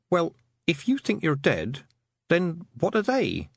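Tiffany, puzzled. Acted. Tiffany questioning the Feagle.

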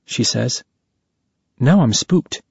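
plain reading voice

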